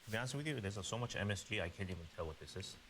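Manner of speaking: ordinary talk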